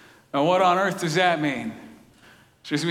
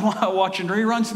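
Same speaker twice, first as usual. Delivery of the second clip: ordinary delivery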